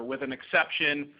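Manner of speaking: ordinary talk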